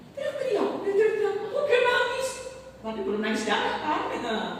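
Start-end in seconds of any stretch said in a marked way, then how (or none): 0.1-2.3 high, whiny mock voice; mock whining complaint. Pastor mocking a believer who whines, high and whiny. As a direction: parody a complainer.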